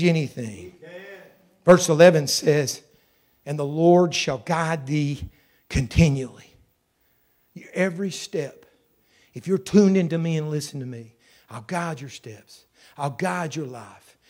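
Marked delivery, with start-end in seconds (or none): 3.5-6.4 scripture reading. Acted. 9.5-14.2 tender. Acted. God's voice softly promising.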